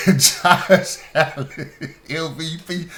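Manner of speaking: can't get it out without cracking up; laughing. Real, not acted. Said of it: "You can't even say it with a straight face".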